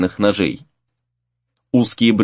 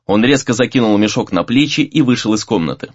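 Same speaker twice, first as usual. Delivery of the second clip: usual speaking voice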